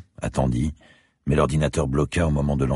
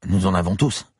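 put-on higher, young student's voice; 6 semitones higher than usual. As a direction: voice the eager student. Student character voice. (voix d'étudiant plus aiguë)

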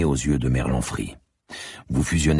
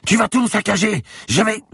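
very high, panicked protest; yelling; 4 dB louder than usual. A narrator's reading. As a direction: shriek at someone wrecking your shop. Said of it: "hurla-t-il". (cri suraigu de protestation)

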